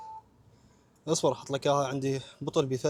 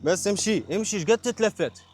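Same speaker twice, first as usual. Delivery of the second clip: raised, pushy call to hurry; calling out impatiently; 6 semitones higher, 4 dB louder than usual. Real, not acted. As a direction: hurry them along loudly. Urging someone to keep walking.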